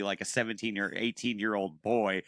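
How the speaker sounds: usual speaking voice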